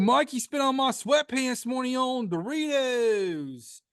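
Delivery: a shaky attempt at a Baltimore accent. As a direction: lay on a Baltimore accent for the intro. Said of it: "I'm trying to do my Baltimore accent".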